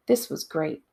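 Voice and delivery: ordinary delivery, nothing marked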